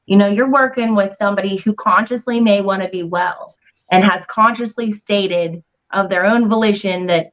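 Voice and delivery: ordinary delivery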